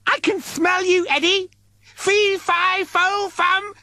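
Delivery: very high, gleeful monster voice; sing-song it like a giant who smells prey; high-pitched inhuman voice. Acted. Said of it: "high-pitched, inhuman scream".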